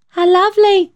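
put-on high, delighted little-boy squeal; a boy thrilled by a sovereign; high, thrilled child's exclamation; excited child cry. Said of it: "Billy cried".

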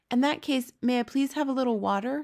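high-pitched, polite request; Sophie, polite little girl. A narrator's reading. Sophie asks the giant for water, politely. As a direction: ask politely in a child's voice.

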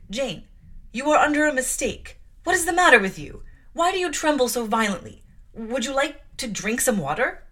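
loud, hurried, nervous placating; flustered aunt. Acted. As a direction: try to calm her while rattled. Mrs Reed, shaken, placates Jane.

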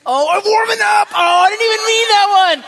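high, loud shout broken by laughter; laughing shout. Real, not acted. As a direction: shout it, cracking up at the pun. Preacher shouts, laughing at his pun.

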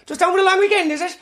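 very high squeaky nosy voice; helium voice. Pretend. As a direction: squeak like you inhaled helium. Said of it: "high on helium".

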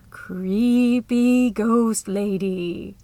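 slow, drawn-out spooky ghost-story voice. Acted. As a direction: stretch it out like telling a ghost story. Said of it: "She'd switched to a spooky voice".

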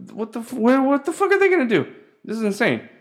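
high, incredulous outburst; exasperated disbelief. Real, not acted. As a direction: pitch it up in baffled protest. Voices his disbelief at the stools.

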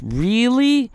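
high, disbelieving really; incredulous really. Real, not acted. Incredulous challenge to listeners.

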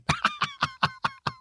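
quick high laugh at your own joke. Real, not acted. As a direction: chuckle high at the joke you just made. Laughs at own rule-exempt joke.

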